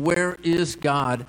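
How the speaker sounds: slowed, searching question. Shown as a demonstration. Pastor asking the congregation, slowly searching. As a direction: let it hang, slow and searching. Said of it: "ask that question".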